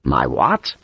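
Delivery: low growled question. A narrator's reading. Sharp to his unexpected defending officer, growling. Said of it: "Sharp growled it".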